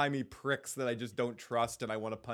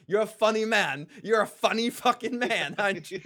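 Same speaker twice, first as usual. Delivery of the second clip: louder, higher, mocking threat; sarcastic menace, much louder; menacing sneer; 8 semitones higher, 8 dB louder than usual. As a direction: spit it at him like a threat. Ned snapping at Littlefinger.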